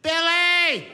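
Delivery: high, loud call to wake him. Acted. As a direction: shout his name to rouse him. Calling coma patient again.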